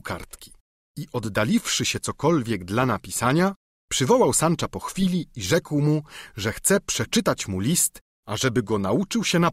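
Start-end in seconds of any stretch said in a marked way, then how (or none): none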